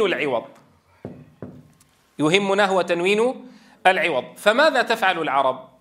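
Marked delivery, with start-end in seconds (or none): none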